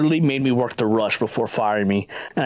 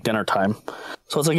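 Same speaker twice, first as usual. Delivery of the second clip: ordinary talk, nothing marked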